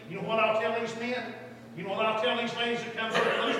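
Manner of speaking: normal talking voice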